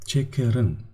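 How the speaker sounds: normal talking voice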